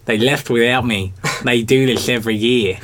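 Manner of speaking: broad Aussie Sid voice; Australian accent. Pretend. Canadian friend doing Sid in an Australian accent. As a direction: Sid as an average Aussie bloke. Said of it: "doing an Australian accent really well".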